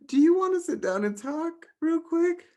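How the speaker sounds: hammed-up concern, very high pitched; playing an overly worried friend; alarmed friend. Exaggerated concerned friend reacting.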